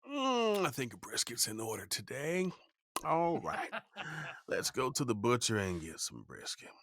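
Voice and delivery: high sleepy hum into a musing self-quote; waking-up musing. Acted. Barbecuer talking himself awake, playful acted musing. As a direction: play yourself deciding on the day's meal.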